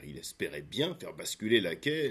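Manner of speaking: ordinary delivery, nothing marked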